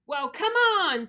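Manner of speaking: slow, high, booming challenge; loud big goat voice. A narrator's reading. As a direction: dare the troll at full volume. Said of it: "as loud as the troll's".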